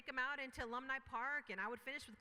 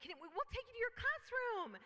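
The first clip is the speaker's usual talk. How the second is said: much higher, bright eager helper voice; perky student impression; 12 semitones higher, 4 dB louder than usual. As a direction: chirp it like an overeager welcomer. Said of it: "so they, they said".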